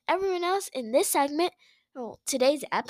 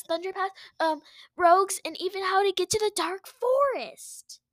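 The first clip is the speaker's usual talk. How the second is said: high, whiny begging voice; pleading villain cat; 7 semitones higher than usual. Acted. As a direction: beg like a spoiled villain. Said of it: "Tiger Star appears".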